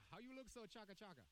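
light Jamaican patois lilt repeated on request. Shown as a demonstration. Repeating the patois line for his cohost, mild Jamaican patois. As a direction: say it again in a mild Jamaican accent. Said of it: "Can you say that again?".

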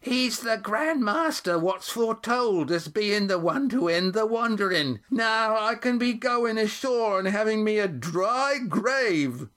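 put-on an old woman straining to be heard; squeaky old woman. A narrator's reading. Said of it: "Her old voice squeaked".

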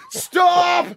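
sharp high yell of stop; mock alarmed shout across the golf course; retold shout. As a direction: call out to halt the cart. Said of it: "All the boys are going".

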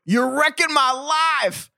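high, slow, hammed-up movie whine; a bad, drawn-out Ricky Bobby voice. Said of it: "it was a terrible accent".

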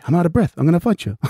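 mock Mark Wahlberg. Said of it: "the Mark Wahlberg Bostons".

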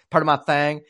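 Southern twang, saying THING as "thang"; Southern accent. Shown as a demonstration. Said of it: "I technically can do a Southern accent".

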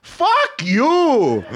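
very high, loud mock yell; mock rage. Dad cursing after Kelly's call, hammed-up fury. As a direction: imitate a dad exploding after hanging up. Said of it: "So, so full of rage".

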